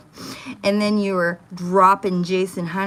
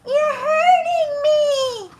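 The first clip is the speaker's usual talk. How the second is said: put-on very high, slow, tearful child's plea; imitating a crying little girl; 6 dB louder, much slower than usual. Retelling a recording, voicing the crying little girl. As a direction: wail it like a hurt little girl. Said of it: "you can hear the little girl go".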